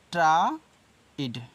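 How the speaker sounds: "ट्राइड" said slowly, each sound drawn out; pronounce tried. Shown as a demonstration. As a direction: stretch out "ट्राइड" slowly and clearly. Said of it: "ऐसे". ("ट्राइड" को धीरे-धीरे खींचकर बोलो)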